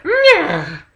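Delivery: very high mock wailing whimper; wife's whimper cry. Wife panics as husband walks away, high mock wail. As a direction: squeal it like a scared woman. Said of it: "That voice, like".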